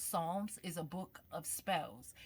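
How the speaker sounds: usual speaking voice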